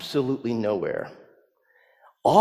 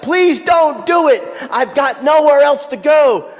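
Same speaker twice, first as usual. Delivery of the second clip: much higher, louder desperate plea; crying out; 10 dB louder than usual. Retold or imitated. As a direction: cry out begging not to be thrown out. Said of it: "screaming and sobbing, and he cries out".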